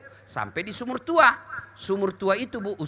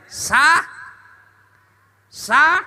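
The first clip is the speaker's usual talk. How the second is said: loud, higher shout; loud higher approving shout; loud calling out; 7 semitones higher, 6 dB louder than usual. Real, not acted. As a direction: call it out to the crowd. Calling out to the crowd.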